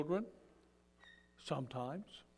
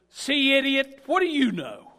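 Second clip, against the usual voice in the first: loud, high mocking outburst; scornful shout; 10 semitones higher, 13 dB louder than usual. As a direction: throw it back in their face, loudly. Peter imagines mocking Jesus.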